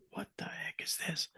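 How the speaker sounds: whispered in amazement; hushed, breathless wonder. Retold or imitated. Man whispering at the cricket in his hand.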